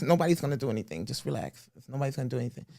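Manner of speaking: soft, reassuring impression; calm everyone down in an impression, voice dropping; Mike Tyson. Mike calming people after dude left, soft reassurance. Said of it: "one of my favorite ones doing".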